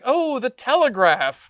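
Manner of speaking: drawn-out, high-swooping exclamation of discovery; Mister Burns. Retold or imitated. Podcaster retelling a Simpsons avalanche scene, imitated slow, high exclamation. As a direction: imitate a cartoon character slowly exclaiming at a find. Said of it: "And Mister Burns sees".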